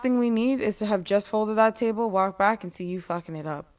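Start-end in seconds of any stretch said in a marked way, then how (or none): none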